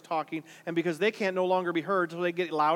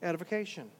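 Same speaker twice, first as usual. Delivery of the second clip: soft repeat; 2 semitones lower, 10 dB quieter than usual. Shown as a demonstration. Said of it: "there's that word again".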